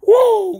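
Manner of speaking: high, loud "woo"; excited whoop. Real, not acted. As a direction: let out a big happy whoop. Waking up thrilled.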